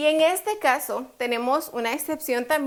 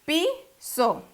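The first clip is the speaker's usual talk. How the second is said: PI-so, split with higher PI; syllable by syllable; 4 semitones higher than usual. Shown as a demonstration. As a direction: sound out Piso in two separate beats. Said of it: "¿Dónde va la fuerza?".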